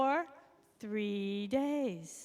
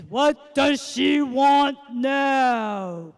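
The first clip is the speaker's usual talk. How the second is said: slow, drawn-out, much louder exasperated fish; booming weary magic fish; 12 dB louder, much slower than usual. Acted. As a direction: stretch it out, fed up with her. Fish annoyed at yet another wish.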